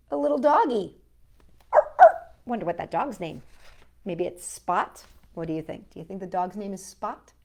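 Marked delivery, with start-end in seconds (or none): none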